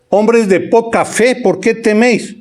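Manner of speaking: a light voiced rebuke of the disciples; Jesus rebuking. Preacher repeats Jesus' rebuke.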